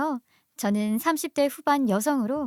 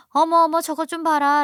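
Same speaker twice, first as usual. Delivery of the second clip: high, loud "oh my" outburst; scandalized exclamation; 5 semitones higher, 6 dB louder than usual. A narrator's reading. Mother-in-law reacting to daughter-in-law shaking off her son, scandalized.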